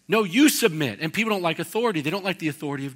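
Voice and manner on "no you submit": louder, higher with a punch on "you"; firmly override the other side, hitting "you"; insistent correction. Acted. God firmly corrects those who reject him, punching "you".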